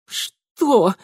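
high, strained groaning question; pained moan. Acted. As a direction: moan it with your throat pressed. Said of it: "простонала Марьянка". (страдальческий стон)

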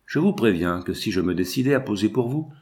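plain reading voice

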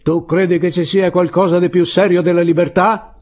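high, loud challenging question; defiant challenge. A narrator's reading. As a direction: challenge him from the parapet. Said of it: "chiese con tono di sfida".

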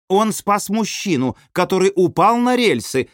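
high, insistent proclamation; excited exclamation. A narrator's reading. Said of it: "восклицают ворота". (восклицая, высоко и громко)

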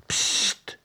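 secretive psst in the dark; whispered attention-getter; hissed "pst". A narrator's reading. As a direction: get someone's attention unseen. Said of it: "hoorde ze opnieuw".

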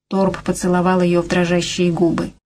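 plain reading voice

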